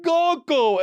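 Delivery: high-pitched mock wail; screaming, crying toddler. Toddler pleads to dad, mock screaming and crying. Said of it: "he's just screaming, crying".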